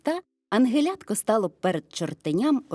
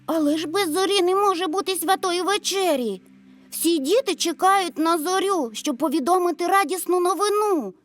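put-on high, loud child's protest; boy exclaiming; 10 semitones higher, 4 dB louder than usual. A narrator's reading. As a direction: plead loudly as a little boy. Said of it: "вигукнув Максим".

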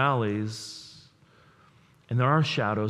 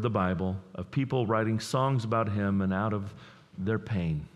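normal talking voice

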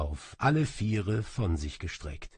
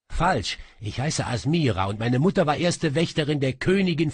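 louder, higher, fast proud outburst; defiant; 6 semitones higher, 5 dB louder, faster than usual. Acted. Asmira defies the sorcerer, loud and proud. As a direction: throw your pride in his face.

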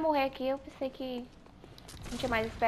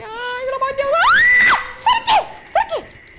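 high shriek fading into pleas; panicked scream; 12 dB louder than usual. Real, not acted. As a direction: scream then fade to pleading. Monster attacks, scream fading.

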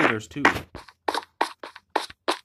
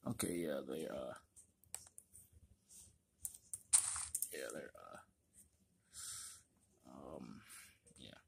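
low unvoiced murmur; distracted, almost silent; whispering; 9 semitones lower, 18 dB quieter than usual. Real, not acted.

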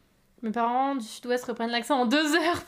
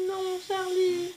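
slow mock reproach; 7 semitones higher, much slower than usual. Teasing reproach to a viewer.

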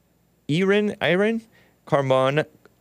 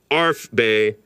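ordinary talk, nothing marked

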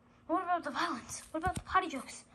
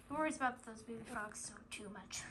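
whisper; 5 semitones lower, 10 dB quieter than usual. Acted. Aside to reader about Flippy.

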